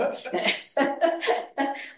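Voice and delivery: high airless giggle; breathless laugh. Real, not acted. After teasing Megan about her nose, airless high giggle.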